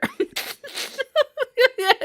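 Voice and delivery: a bright, high giggle; high laugh. Real, not acted. Reader laughs at her comfy-spot image, high giggle.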